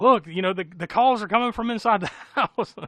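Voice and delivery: loud, high, panicky blurting; comic panicked outburst; mock freak-out. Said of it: "he just instantly freaks out".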